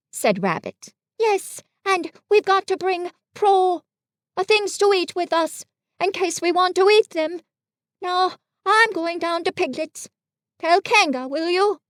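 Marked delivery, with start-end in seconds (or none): 1.2-11.8 high storybook bear voice; Pooh, busy messenger. Acted. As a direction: pass on instructions in a high character voice.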